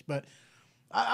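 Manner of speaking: normal talking voice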